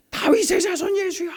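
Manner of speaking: high imitated cry of the beggar; mock pleading call; cried out. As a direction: reach up to call Jesus. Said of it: "외쳤단 말이에요".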